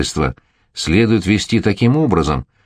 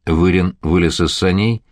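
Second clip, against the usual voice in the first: usual speaking voice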